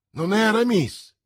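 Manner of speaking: loud, high cry of surprise; exclaiming. A narrator's reading. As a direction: cry it out, pitch jumping up sharply. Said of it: "esclamò". (esclamazione forte e acuta)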